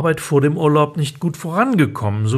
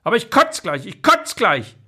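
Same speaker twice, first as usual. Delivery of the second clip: put-on high, fast childish insisting; whiny child; 6 semitones higher than usual. Louis insists on gum to his mother, high and fast. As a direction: insist like a stubborn little boy.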